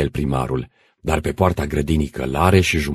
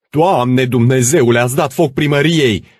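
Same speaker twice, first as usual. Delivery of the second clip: horrified bellow; 10 semitones higher, 6 dB louder than usual. Acted. Administrator seeing smoke over the town hall, bellowing. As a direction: roar it in horror. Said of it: "răgni administratorul".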